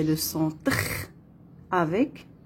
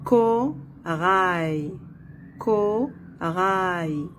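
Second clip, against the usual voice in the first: "corail" in two beats, co, pause, rail; split into syllables; much slower than usual. Shown as a demonstration. Teacher locating the sound in a syllable, split into syllables. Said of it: "dans la deuxième syllabe".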